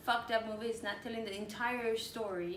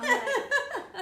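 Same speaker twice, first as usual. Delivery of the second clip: higher and louder, slow, through laughter; laughing; 9 dB louder than usual. Real, not acted. Guest cracks up at the host's joke, laughing. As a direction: laugh the words out.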